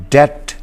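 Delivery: ڈیٹ said slowly, b silent; model pronunciation. Shown as a demonstration. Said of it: "number تین پہ لفظ".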